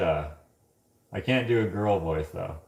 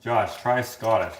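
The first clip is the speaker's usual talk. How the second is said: ordinary talk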